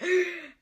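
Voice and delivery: sharp shocked mock gasp; offended gasp. Offended customer gasping at the cashier's retort. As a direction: gasp like you can't believe her nerve. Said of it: "And she was like".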